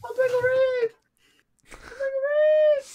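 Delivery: very high squealed catchphrase; badly faked cartoon scientist squeal; Pickle Rick. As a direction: screech it way up high. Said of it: "I thought it was a good Rick voice".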